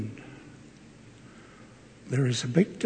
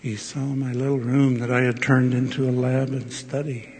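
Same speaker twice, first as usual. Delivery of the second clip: usual speaking voice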